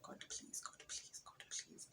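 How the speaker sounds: breathless whispered begging; desperate whispered prayer, repeating. Retold or imitated. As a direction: whisper it over and over to God. Praying, couldn't form prayer.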